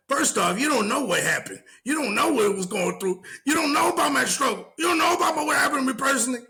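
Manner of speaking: mock high-pitched yelling, rising into a shout; imitating her rant. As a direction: squeal it out like an outraged woman, getting shriller. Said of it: "she was like".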